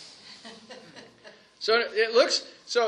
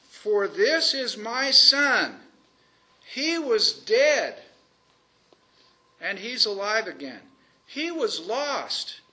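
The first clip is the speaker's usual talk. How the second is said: slow proclamation; 4 dB louder, much slower than usual. Acted. Proclaims the father's words slowly.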